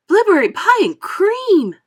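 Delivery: high, loud, slow triumphant shout. A narrator's reading. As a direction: crow it out in delight. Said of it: "shouted Violet".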